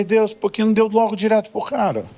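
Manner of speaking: voz bem mais aguda, incrédula e indignada; pergunta indignada a Deus. Retold or imitated. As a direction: jogue a pergunta pra cima, sem acreditar. Pastor questions God, exasperated. (pastor questionando Deus, indignado e incrédulo)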